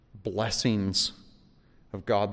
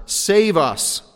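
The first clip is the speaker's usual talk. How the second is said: raised, higher plea; pleading cry; 8 semitones higher, 7 dB louder than usual. Retold or imitated. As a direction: cry out for rescue.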